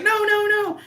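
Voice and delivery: high panicked no; exasperated no. Retold or imitated. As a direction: shut it down in alarm. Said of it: "the guy's like".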